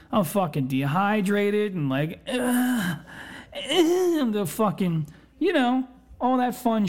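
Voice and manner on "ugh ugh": acted queasy groan, pitched up; hungover groan. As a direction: groan as if sick and dehydrated. Acts out being hungover, dehydrated.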